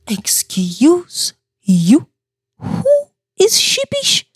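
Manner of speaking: whispered, indignant, pitch jumping high on sheepish; a breathy mock-offended whisper rising to a high squeak. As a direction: hiss it back, mock-offended. Mock-offended reply to her husband.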